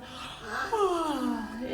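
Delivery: soft drawn-out pretend yawn; mock yawn. Shown as a demonstration. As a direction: yawn big for the kids. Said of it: "you can yawn".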